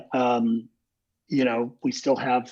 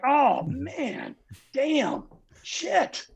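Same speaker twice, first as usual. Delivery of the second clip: awed swearing; 12 semitones higher, much slower than usual. Retold or imitated. Crew freaking out.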